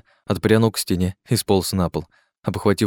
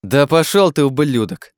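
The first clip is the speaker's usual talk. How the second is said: loud, high indignant curse; angry retort; 7 semitones higher, 4 dB louder than usual. A narrator's reading. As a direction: hurl the insult back loudly. Angry retort to threat and insult.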